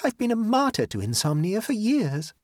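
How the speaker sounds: high-pitched, dignified protest; with dignity. A narrator's reading. Lola answering the suggested remedies, with dignity. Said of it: "said Lola with dignity".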